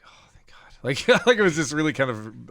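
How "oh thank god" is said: whispered, relieved thank God; a whispered sigh of relief. Retold or imitated. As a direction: breathe it out in relief. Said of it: "I felt peaceful".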